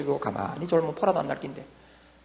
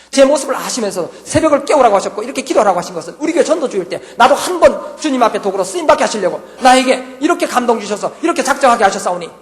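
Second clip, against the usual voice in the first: fervent prayer; 6 semitones higher, 11 dB louder than usual. Retold or imitated. Retold fervent dawn prayer.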